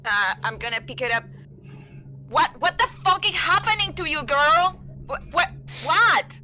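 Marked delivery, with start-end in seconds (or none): 2.3-6.4 high, fast, loud alarmed shouting; shocked yelling. Acted. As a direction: yell it in total disbelief. Player shocked at teammate's panic.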